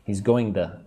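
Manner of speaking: to joined onto going as "duh"; to reduced to duh right after going; linked "going to". Shown as a demonstration. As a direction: say going to as one word, "going-duh". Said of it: "put it with the word going".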